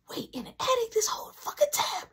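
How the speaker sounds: high, incredulous whispered cry; shocked whisper. Retold or imitated. Learning brother hid in the attic, shocked whisper. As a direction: whisper in disbelief.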